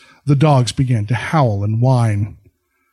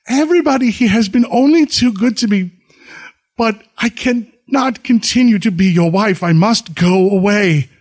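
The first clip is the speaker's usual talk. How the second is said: higher voice, holding back tears; sobbing; 7 semitones higher than usual. A narrator's reading. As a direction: plead through tears. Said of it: "she answered, sobbing".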